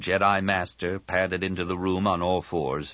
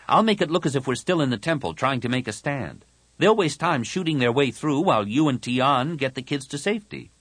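put-on higher, earnest boy's voice; determined young Anakin; 7 semitones higher, 3 dB louder than usual. Anakin lays out his decoy plan, determined. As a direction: lay out the plan, pitched up.

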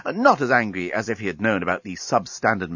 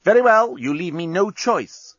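snarling; 8 semitones higher, 3 dB louder than usual. A narrator's reading. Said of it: "snarled Bolfas".

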